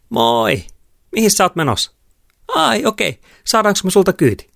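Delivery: put-on very high, loud girlish pleading; girl hitching a lift. A narrator's reading. Girl asks driver for a lift. (kimeä kyytiä pyytävä tyttö)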